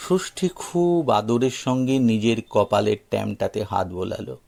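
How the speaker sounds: plain reading voice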